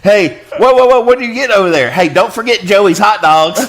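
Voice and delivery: high, loud hammed-up shout; mock parent calling. Parent calls out reminding about Joey's hot dogs, hammed-up. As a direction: call across the store, mock urgent.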